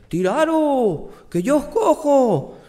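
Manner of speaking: high, slow, drawn-out call upward. Acted. Basque man calls up to the people in the windows, high and drawn out. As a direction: shout it up to the windows, confident.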